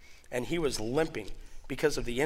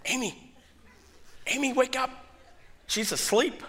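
urgent nudge; 10 semitones higher than usual. Retold or imitated. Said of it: "I'm like".

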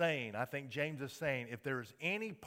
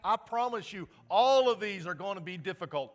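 loud, higher warning sermon; full-force preaching; 8 semitones higher, 11 dB louder than usual. Acted. Pastor warning the congregation about strife, in loud high preaching. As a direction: warn them hard.

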